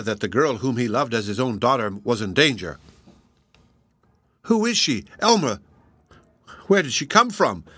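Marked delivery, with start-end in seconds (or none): 4.4-7.6 high-pitched, startled demand; urgent exclamation. A narrator's reading. Shaken father questions his host about the girl, exclaiming. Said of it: "exclaimed Mister March".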